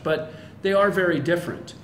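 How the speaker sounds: usual speaking voice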